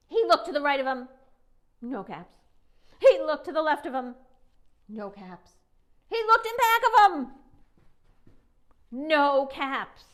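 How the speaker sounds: loud, fast looking around; building alarm. A narrator's reading. Narrator builds alarm as caps go missing.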